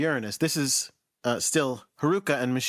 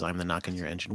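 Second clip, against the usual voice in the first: low, quieter mock monster; 9 semitones lower, 6 dB quieter, faster than usual. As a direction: growl it lightly as the monster. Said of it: "or maybe the monster says".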